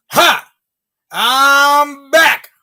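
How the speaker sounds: devil's triumphant shout. Acted. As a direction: crow it out like the devil returning home.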